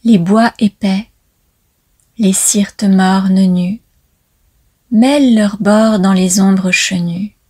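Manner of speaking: hushed, falling cadence at line ends; poetry recital. Acted. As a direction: recite it line by line with long pauses. Commencer cette danse poétique par un poème.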